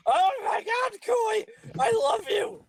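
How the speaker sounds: high excited fangirl shout; fangirl squeal. Real, not acted. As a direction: squeal over your anime crush. Fangirling over anime character.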